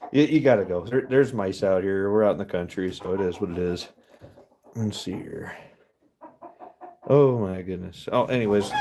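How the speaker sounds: normal talking voice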